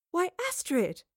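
high-pitched gasping astonishment; astonished greeting; surprised gasp. A narrator's reading. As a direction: exclaim in surprise, pitched well up. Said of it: "Jane gasped".

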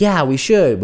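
lightly hammed-up excitement; excited. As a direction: play an enthusiastic texted yes. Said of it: "with excitement, exclamation mark".

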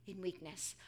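hushed, measured reassurance; quiet, tender authority; gentle divine answer. Acted. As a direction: speak softly and low, leaving space between phrases. Voicing God's gentle answer to Paul.

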